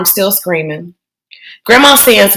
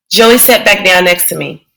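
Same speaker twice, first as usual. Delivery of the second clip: ordinary delivery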